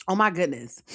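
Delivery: ordinary delivery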